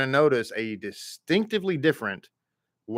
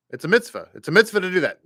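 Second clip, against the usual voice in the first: MITZVAH as "MITS-vuh", higher-pitched Texas twang; American Texas twang; 6 semitones higher, 3 dB louder, much faster than usual. Shown as a demonstration. Texan teacher showing how the South says mitzvah, American twang. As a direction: say mitzvah the American way, short first vowel. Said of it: "adding our American twang to it, our Texas twang".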